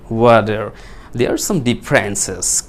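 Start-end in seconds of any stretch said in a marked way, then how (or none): 0.1-0.7 American "ওয়াটার" with t as d and r clearly said; American accent. Shown as a demonstration. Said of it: "ট সাউন্ডটি আবার ডয়ে রূপান্তর হলো". (American উচ্চারণে "ওয়াটার", ড আর র স্পষ্ট)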